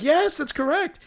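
high, celebratory game-show confirmation; excited host. Retold or imitated. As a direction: imitate a host thrilled by a right answer. Imitating host cheering a right answer.

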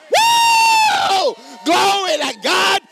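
high, loud exultant cry of glory; shouted praise. Acted. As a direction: shout it out like you're on fire for God. Acted worshipper shouting praise.

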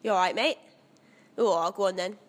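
loud, hammy Cockney geezer; bad Cockney London accent, loud; terrible mock Cockney greeting, shouted; horrible Cockney. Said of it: "really horrible Cockney London".

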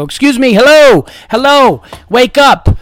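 loud, high, indignant yelling; sarcastic, fed-up calling out; angry outburst. Acted. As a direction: tear into inattentive staff. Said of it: "I'm getting angry".

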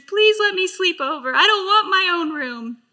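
put-on little girl begging her sisters, tearful; pleading child. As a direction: beg like a scared little girl. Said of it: "I begged my sisters".

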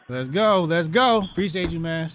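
loud, high hype shouting to the chat. Real, not acted. Host hyping the chat and the new supporter, loud excited shouting. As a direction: fire up the crowd, all out.

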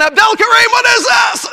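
put-on shouted in a Middle Eastern father's accent; angry father shouting in an Arabic accent; dad's Arabic accent. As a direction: yell his name like an angry Arabic-speaking dad. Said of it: "got that voice, you know, that accent".

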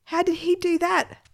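high incredulous question; baffled boy. A narrator's reading. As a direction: ask in wide-eyed disbelief.

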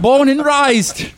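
drawn-out, high, loud mock Aussie; hammed-up Australian holler; horrible Australian accent. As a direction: yell it slowly in bad Australian. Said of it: "I have to yell it".

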